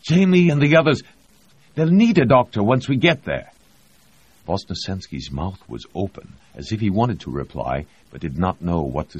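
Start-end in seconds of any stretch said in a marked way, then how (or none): 0.0-3.5 high and loud, insistent; urgent plea. A narrator's reading. Reed urging Vosnesensky that they need a doctor, loud. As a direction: press the case hard.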